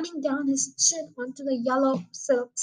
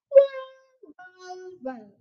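pompous policeman; 4 semitones higher, much slower than usual. A narrator's reading. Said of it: "puffing out his chest".

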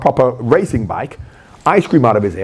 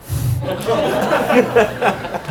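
a burst of high-pitched laughter; 10 semitones higher than usual. Real, not acted. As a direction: laugh high and long at the memory. Laughing at Gazza.

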